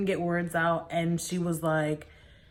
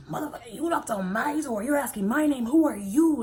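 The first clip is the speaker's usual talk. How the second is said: retold angry outburst; 5 semitones higher than usual. Grandmother challenges the stranger at her door, mock angry. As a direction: act out a woman going off on a caller.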